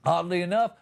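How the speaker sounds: normal talking voice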